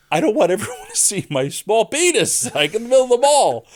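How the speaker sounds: high, loud embarrassed wail, speeding up; mortified outburst. Retold or imitated. Pantsed comedian complaining to Tommy in the mall, high loud mortified wail. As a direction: wail it out in full-blown embarrassment. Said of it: "And he's just like".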